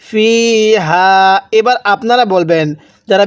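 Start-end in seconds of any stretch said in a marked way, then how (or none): 0.0-1.4 ফিহা with the vowel held very long; ফিহা drawn out in tajweed style. Shown as a demonstration. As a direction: stretch ফিহা slowly as in recitation. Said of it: "এরপরে".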